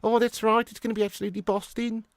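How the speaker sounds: high, lilting Brummie chat; high-pitched Birmingham accent. Shown as a demonstration. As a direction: put on a broad Brummie. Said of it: "Brummie's quite decent as well".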